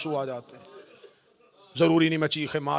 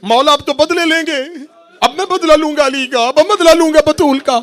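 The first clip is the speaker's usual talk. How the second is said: higher, louder, rising eagerness with each vow; joyful battle oath, climbing louder; eager vow; 11 semitones higher, 15 dB louder than usual. Acted. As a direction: Abbas swearing revenge, fired up. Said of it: "Abbas के चेहरे पे खुशी आई".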